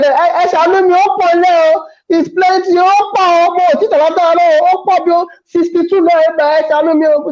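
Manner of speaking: high put-on pleading voice, fast at the end; high mock pleading seller. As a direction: beg for pity like the rice seller. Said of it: "She said".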